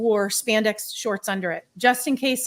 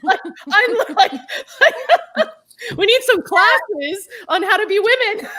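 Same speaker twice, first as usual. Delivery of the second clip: laughing; 13 semitones higher, 7 dB louder than usual. Real, not acted. Laughing with hosts.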